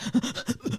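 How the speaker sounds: sudden unvoiced gasp for air; startled breathless gasp; sharp gasp. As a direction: gasp like you just surfaced. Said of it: "your body being like".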